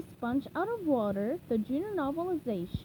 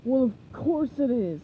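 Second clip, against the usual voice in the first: lightly voiced pirate, insisting; a hint of a smug pirate; mild insistent pirate; 5 dB louder than usual. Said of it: "Burger Beard insisted".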